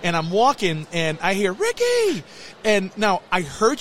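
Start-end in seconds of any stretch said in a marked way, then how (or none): 1.5-2.2 high call across a crowd; stretched high shout of a name. Retold or imitated. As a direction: call his name from far off. Calling out across theme park.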